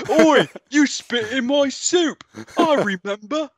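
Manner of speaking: very high, slow, loud bad Cockney; hammed-up Cockney orc grumble; terrible Cockney accent. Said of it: "It's a terrible Cockney accent".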